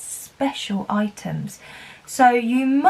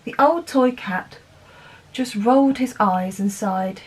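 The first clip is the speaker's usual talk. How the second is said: ordinary talk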